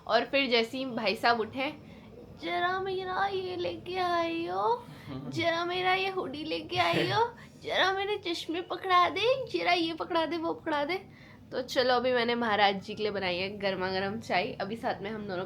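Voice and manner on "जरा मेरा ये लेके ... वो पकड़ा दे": high, slow whiny demanding voice; high whiny mock of a husband's requests; mimicking lazy husband. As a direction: ape him ordering her around, drawn out.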